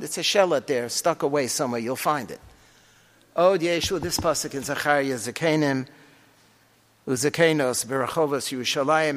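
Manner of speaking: usual speaking voice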